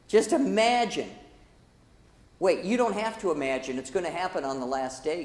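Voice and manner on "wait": ordinary delivery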